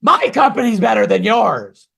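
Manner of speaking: loud high mocking brag; mock boast. Mimicking a rival recruiter's loud boast. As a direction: throw back a petty boast. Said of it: "And they go".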